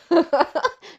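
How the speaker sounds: high burst of laughter; real laugh. Real, not acted. Laughing at herself for wanting to cheat, a high real laugh. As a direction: laugh at being caught out.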